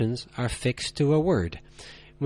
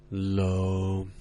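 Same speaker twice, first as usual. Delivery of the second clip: "low," held deep, lower and flat; low level tone; 5 semitones lower, 5 dB quieter than usual. Shown as a demonstration. Teacher showing learners the third tone, low and flat. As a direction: drop "low," to the bottom and hold it. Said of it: "Try it again".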